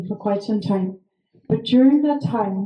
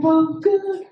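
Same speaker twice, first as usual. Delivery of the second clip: nervous; 6 semitones higher than usual. Retold or imitated. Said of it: "I'm like".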